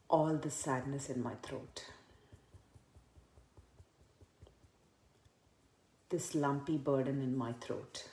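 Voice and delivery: hushed repetitive tapping round; tapping reminder phrases. Shown as a demonstration. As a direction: murmur each phrase softly while tapping.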